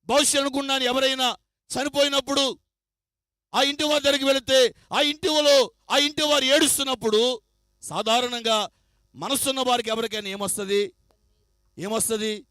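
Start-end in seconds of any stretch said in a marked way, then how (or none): none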